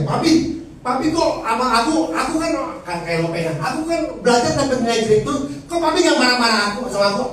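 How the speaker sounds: a hint of a puzzled little kid asking dad; child asking. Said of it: "anak saya tanya".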